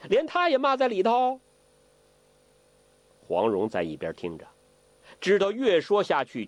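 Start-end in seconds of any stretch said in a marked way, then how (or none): 0.1-1.4 put-on high, baffled old prankster; puzzled old imp. A puzzled Zhou Botong asks Ke, higher. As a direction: ask it like a wide-eyed rascal. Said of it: "周伯通奇怪".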